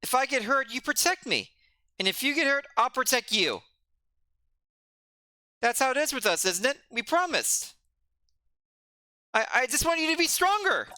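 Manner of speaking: put-on a kid demanding why his friend fell on purpose; upset young friend.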